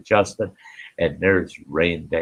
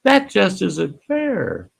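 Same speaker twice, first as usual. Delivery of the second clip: slow, much higher whine on "fair"; mock whiny complaint; 11 semitones higher, slower than usual. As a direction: mimic a complainer. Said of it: "when people tell me".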